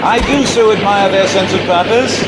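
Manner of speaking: wry shout. Acted. Wry remark while fleeing.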